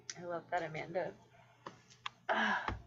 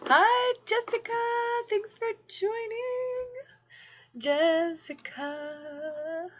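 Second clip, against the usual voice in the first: high, drawn-out welcome; sing-song greeting; 13 semitones higher, much slower than usual. Real, not acted. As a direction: greet them in a high singsong.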